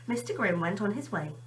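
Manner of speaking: ordinary delivery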